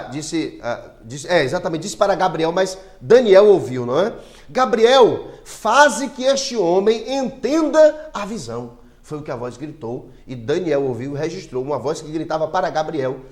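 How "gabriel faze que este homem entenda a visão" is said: higher, slow, drawn-out proclamation; mild quoted cry. Acted. Teacher quoting the voice that cried to Gabriel, mildly raised call. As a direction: call out a solemn command, raised but measured. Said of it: "a qual gritou e disse".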